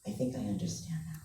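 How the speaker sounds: whispered low and small; meek whisper. Retold or imitated. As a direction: barely breathe the words out. Daughter answering meekly.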